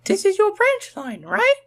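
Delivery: very high, sweet little engine. A narrator's reading. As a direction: voice a small girl engine. Rosie asks Thomas.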